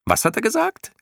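very high squeaky creature voice; clown moth asking. A narrator's reading. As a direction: pipe it from far up. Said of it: "Eines von ganz oben fragte". (piepsige Mottenstimme, sehr hoch fragend)

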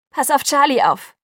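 high, loud urgent call. A narrator's reading. Bella calls from the car to Jacob, shouting. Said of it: "rief ich aus dem Fenster".

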